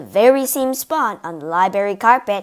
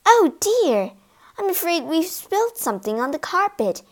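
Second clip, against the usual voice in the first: high, flustered lady's voice; dismayed cry; 7 semitones higher than usual. A narrator's reading. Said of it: "Missus Otis cried out".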